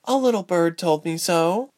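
high, knowing, secretive tone; mysterious smile. A narrator's reading. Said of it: "Ilse said with a mysterious smile".

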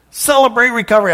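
blurting the name out high and loud; suddenly remembering, call it out; excited exclamation. Real, not acted. Pastor recalls the name to the congregation, excited exclaim.

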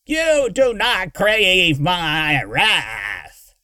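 slow, loud screeched threat; Derek. A narrator's reading. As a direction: screech the threat, stretching every word. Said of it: "Derek screeched".

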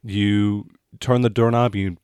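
ordinary delivery

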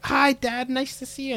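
high-pitched, put-on hello; squeaky little mock-sweet voice; mock cheery greeting. Retold character jumps to hug dad.

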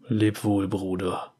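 soft farewell whisper. A narrator's reading. Nasus bids his vanishing brother farewell, whispering. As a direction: let the brother go, barely audible. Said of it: "flüsterte Nasus".

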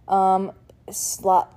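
ordinary delivery

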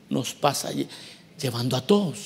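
quiet. Shown as a demonstration. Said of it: "No sé si está oyendo usted".

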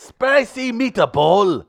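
very slow, loud, high mock-Italian accent; Italian-Russian accent. Shown as a demonstration. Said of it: "That is Italian Russian".

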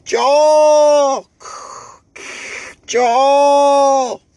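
high, loud drawn-out holler for Joel. Retold or imitated. Imitating a patient hollering for Joel, high loud holler. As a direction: call the name across the ward at full voice. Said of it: "So it's like".

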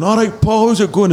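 put-on high-pitched, over-friendly drunk greeting; drunken pest. Drunk warm-up DJ pestering his hero, high-pitched mock greeting. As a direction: pester your hero, mimicking your drunk self. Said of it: "drunk me didn't realise that I was being a pest".

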